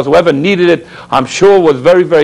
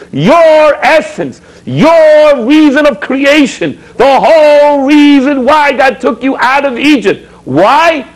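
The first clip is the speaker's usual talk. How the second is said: each phrase stretched slow and higher, pressing hard; drawn-out preaching; 7 semitones higher, slower than usual. Acted. Rabbi pleading with his congregation, slow fervent preaching. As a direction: hammer home why God took you out.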